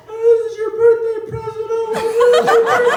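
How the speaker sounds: high, loud hammed-up wailing; mock crying mom. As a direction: ham up a sobbing mother pleading. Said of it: "She starts crying her eyes out".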